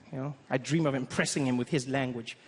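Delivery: normal talking voice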